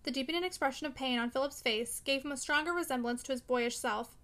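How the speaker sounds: ordinary delivery, nothing marked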